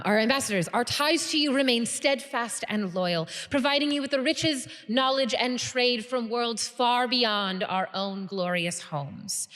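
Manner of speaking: high, loud formal oratory; rally the whole room to join you; rousing guild leader. Acted. Guild leader addresses banquet, rousing speech.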